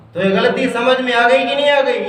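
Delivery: ordinary talk, nothing marked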